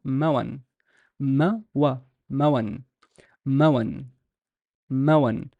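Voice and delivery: "mawan" in two short syllables, ma-wan; "mawan", a short a on each side of the w; two-syllable pronunciation. Shown as a demonstration. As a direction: say "mawan" with the waw as a consonant w. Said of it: "I would pronounce this with the fathas as".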